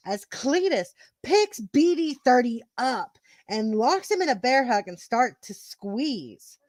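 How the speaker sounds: emphatic match storytelling; wrestling commentary. Acted. Narrator telling the title match to co-hosts, commentary style. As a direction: punch the key words of the action.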